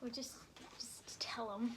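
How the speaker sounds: hushed unvoiced prompt; whisper. Real, not acted. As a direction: whisper it aside.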